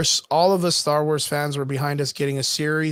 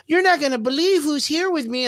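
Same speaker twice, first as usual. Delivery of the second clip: high teasing reveal voice; mock excited heroine; 10 semitones higher than usual. Fan retelling the rickshaw reveal, high mock-excited woman. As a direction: play the eager heroine dragging out a surprise. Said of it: "The chick shows up in the fucking rickshaw".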